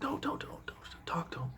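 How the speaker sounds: hushed pleading whisper, no voice; urgent whisper. Retold or imitated. Wife pleads in a whisper not to approach him, urgent whisper.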